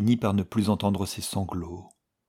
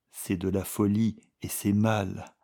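usual speaking voice

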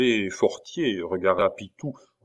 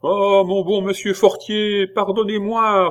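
higher, begging schoolboy voice; pleading; 11 semitones higher, 6 dB louder than usual. A narrator's reading. Schoolboy begging his teacher for forgiveness, pleading. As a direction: beg for mercy. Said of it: "une autre voix suppliante".

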